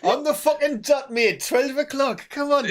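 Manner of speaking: high, loud mock Geordie telling-off; Sarah Millican. Nazi chivvying a mate, loud high Geordie. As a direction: chivvy them in a hammed-up Geordie voice. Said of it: "Nazis played by Sarah Millican".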